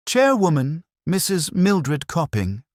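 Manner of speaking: lofty chairwoman. Acted. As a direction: announce her title like a self-important matron. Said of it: "he could almost hear her voice already, the lofty tone".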